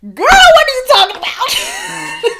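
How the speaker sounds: high, loud, laughing disbelief; gasping laughing shock. Real, not acted. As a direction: burst out in amazed laughter. Reacting to friend's shocking confession.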